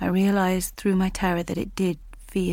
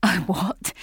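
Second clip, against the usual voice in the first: hissed. Acted. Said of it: "he hissed".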